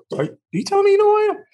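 high, fast, breathless excitement; giddy disbelief. Retold or imitated. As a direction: gush it out in disbelief.

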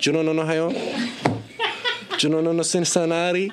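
put-on very high, chant-like Belgian-Cuban accent. Son pestering father about his state, Belgian-Cuban accent. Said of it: "We did that for, like".

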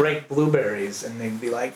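ordinary talk, nothing marked

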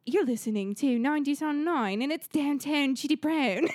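imitated British presenter accent; put-on British DJ accent, drawing out the name; British radio DJ. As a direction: say it as a British station ident. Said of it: "She's, like, British".

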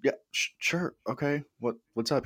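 high, unsure mock stammer; hesitant surprise. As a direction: play your past self caught off guard. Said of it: "I'm like".

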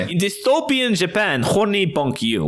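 mock Russian accent. Shown as a demonstration. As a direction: read it in a hammy Russian accent. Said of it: "say it in a Russian accent".

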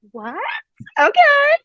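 very high, squeaky retold surprise; high-pitched, giddy mock reaction; starstruck disbelief. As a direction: act out stunned delight in a tiny high voice. Acting out her starstruck reaction.

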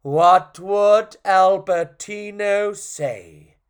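loud, high, very drawn-out question; milk the suspense, word by word; suspenseful narration. A narrator's reading. Storyteller teasing the audience before the answer, loud and drawn out.

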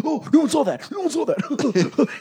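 high-pitched mock embarrassed mumble; flustered friend. Friend grabbing down his blown-up skirt, flustered mock panic. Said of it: "then he'd grab it down and go".

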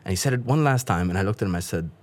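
ordinary delivery

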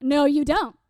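emphatic, moderately forceful denial; firm contradiction. Retold or imitated. Zealous teen corrects her friend, firm denial. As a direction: cut the friend off with certainty.

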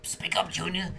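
mock a hard-of-hearing elder, voice lifted; hint of a cranky old-timer; imitated old customer. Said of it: "they all give you the".

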